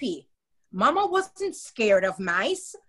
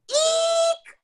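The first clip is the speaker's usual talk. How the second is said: very high, loud squeal of fright; frightened shriek; 4 dB louder than usual. A narrator's reading. Ronald shrieking at the sight of a mouse. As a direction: squeal and leap away. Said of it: "shouted Ronald".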